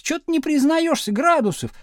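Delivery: high, pestering demand; challenging call. A narrator's reading. Girls challenging their classmate, high and reproachful. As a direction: call him out, pitch well up.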